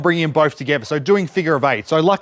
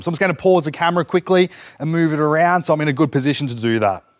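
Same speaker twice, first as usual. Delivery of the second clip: ordinary delivery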